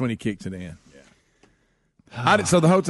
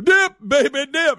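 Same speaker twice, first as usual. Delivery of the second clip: hype shout; 13 semitones higher, 7 dB louder, slower than usual. Retold or imitated. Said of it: "And you go".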